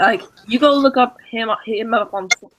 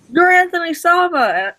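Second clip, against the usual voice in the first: high-pitched retold fan outburst; starstruck exclamation; 9 semitones higher, 4 dB louder than usual. Fan recognizing the Lego train builder he always wanted to meet, starstruck.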